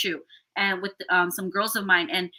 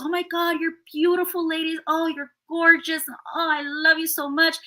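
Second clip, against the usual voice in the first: high, gushing compliments; shower the ladies with praise; 7 semitones higher than usual. Retold or imitated. Cheering on the girls at the photo shoot, high and gushing.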